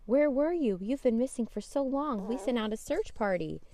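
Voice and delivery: loud, high, worried mother calling out; worried mom. Acted. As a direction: scold like a frantic mom who searched everywhere. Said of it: "said Jane's mom".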